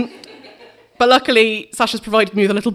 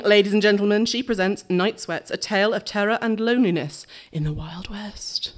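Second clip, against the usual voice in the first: fading into a spooky whisper; vintage horror narrator; 4 dB quieter than usual. Shown as a demonstration. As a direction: draw them into the dark tale. Introducing a horror tale.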